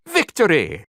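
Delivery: loud victorious cry; triumphant shout. Acted. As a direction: call it out to the troops. Soldier cheers the win.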